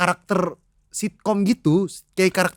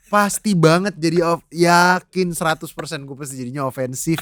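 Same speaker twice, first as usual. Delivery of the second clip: ordinary talk, nothing marked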